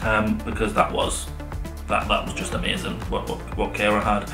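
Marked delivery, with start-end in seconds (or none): none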